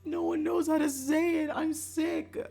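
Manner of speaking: high, gushing squeal building into a loud wail; mock self-congratulation. Streamer mocking past self for choosing a clever name, high mock gushing. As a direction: gush giddily, then cry out in high dismay.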